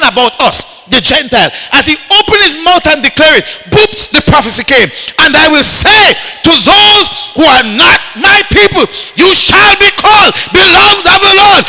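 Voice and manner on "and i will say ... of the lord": loud, high prophetic declaration; full-force preaching. Acted. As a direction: proclaim it over the crowd.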